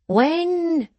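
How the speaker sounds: short, high blunt "When". A narrator's reading. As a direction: cut straight to it. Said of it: "said Venus bluntly".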